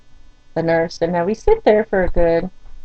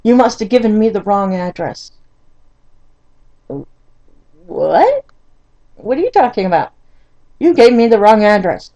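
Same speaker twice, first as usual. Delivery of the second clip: retold yelling; 2 semitones higher, 5 dB louder than usual. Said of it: "he starts to yell at me".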